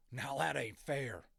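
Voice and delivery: loud, slow cowboy grumble; a sulky cowboy complaining; grumbling cowboy. A narrator's reading. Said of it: "he muttered".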